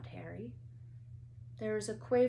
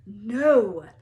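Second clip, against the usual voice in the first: very loud shout; 15 dB louder than usual. A narrator's reading. Hermione shouts to stop Harry, very loud. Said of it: "shouted Hermione Granger".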